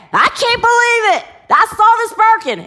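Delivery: high, loud, disbelieving outburst; shocked driver. Retold or imitated. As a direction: burst in stunned by what you saw.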